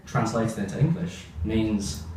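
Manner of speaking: ordinary delivery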